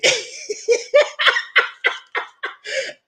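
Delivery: high, drawn-out cackle; helpless high laughter; long laugh. Real, not acted. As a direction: break into a long squealing laugh. Cracks up at own joke.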